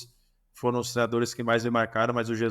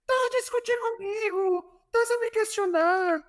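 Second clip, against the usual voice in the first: high-pitched imitation of an indignant coach; Jorge Jesus shouting. Coach challenges player answering back, indignant high mock yell. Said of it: "ele grita assim".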